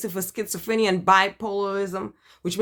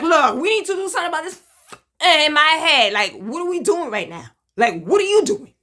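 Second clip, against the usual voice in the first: loud, high, demanding tone; confrontational attitude; 6 semitones higher, 5 dB louder than usual. Retold or imitated. Demanding the doctors act, loud and confrontational. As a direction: push back hard, fast at first. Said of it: "that type of attitude".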